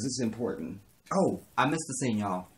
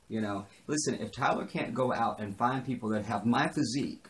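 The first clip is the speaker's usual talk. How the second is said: usual speaking voice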